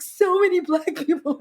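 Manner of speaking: high-pitched, giggly amazement; wonderstruck sister. Retold or imitated. Sister amazed by the crowd, imitated high and giddy. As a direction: imitate someone marvelling, high voice. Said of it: "like she'd never seen people before".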